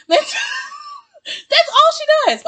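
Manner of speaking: high, laughing exclamation. Real, not acted. Laughing at Cheyenne to her viewers, amused.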